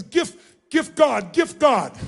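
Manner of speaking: high, loud, slow imitation of her broken English; grateful mother's broken English. Mother thanking the delivery man at her door, high, loud and slow. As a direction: cry it out slowly like an overjoyed mother. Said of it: "And then she finally says".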